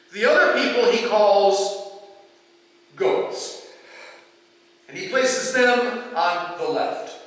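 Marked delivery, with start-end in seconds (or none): none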